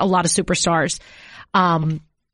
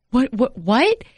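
stunned disbelief; 4 semitones higher, slower than usual. Retold or imitated. Stunned at the cop's news.

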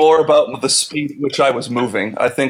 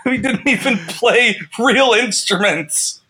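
mock rant; 7 semitones higher than usual. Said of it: "he's like".

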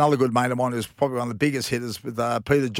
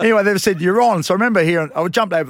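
chuckling through the words; laughing; 6 semitones higher, 5 dB louder, faster than usual. Real, not acted. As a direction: laugh as you say it. Laughing while retelling.

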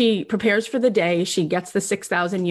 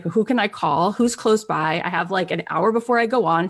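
ordinary talk, nothing marked

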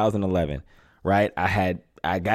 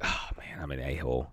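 self-reproach; 10 semitones lower, 13 dB quieter than usual. Retold or imitated. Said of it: "I'm like".

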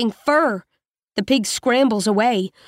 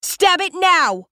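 high, slow urgent yell; screaming; 7 semitones higher, slower than usual. Acted. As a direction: yell it at her in panic. Latu screaming orders.

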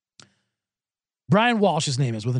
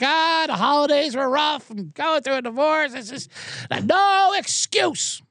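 sheepish excuses; 9 semitones higher, slower than usual. Retold or imitated. His own sheepish excuses.

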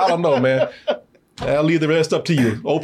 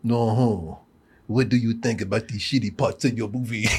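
low, lightly put-on French accent; mock French accent; 5 semitones lower, 4 dB quieter than usual. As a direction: imitate the French questioner's accent, a little lower. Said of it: "lay on that accent thick".

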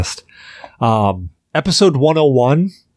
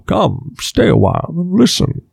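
lightly voiced old sage, higher; a hint of a wise old storyteller beckoning; Deckard Cain voice; 7 semitones higher than usual. Host shows his Deckard Cain voice to the guest, lightly voiced old sage. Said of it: "I got onto that voice".

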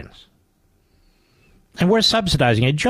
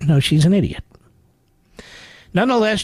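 normal talking voice

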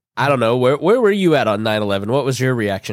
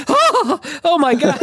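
very high-pitched scandalized gasp; mock horrified; slower than usual. Sweet church girl sees the man, squeaky mock horror.

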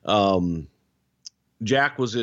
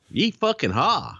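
mock Southern cheer; 6 semitones higher than usual.

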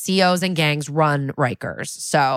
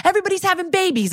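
excited gush; faster than usual. Retold or imitated. Proud new grandpa gushing.

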